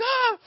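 high loud yelp; panicked scream. Retold or imitated. Boy underwater in panic, high yelp. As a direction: shriek in sudden fear. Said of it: "And so I'm like".

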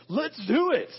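high, loud, excited burst; rally them as an eager teammate would; eager rallying cry. Acted. Preacher acts out an eager Jesus.

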